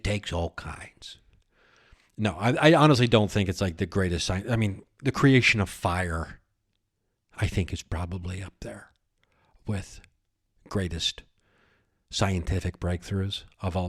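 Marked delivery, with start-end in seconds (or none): none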